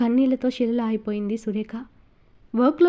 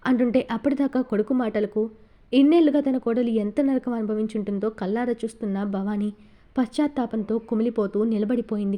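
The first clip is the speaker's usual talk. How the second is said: plain reading voice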